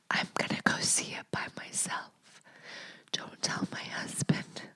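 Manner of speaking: unvoiced conspiratorial whisper; whispering so the husband won't hear. Real, not acted. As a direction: whisper it like a secret. Secret plan from husband.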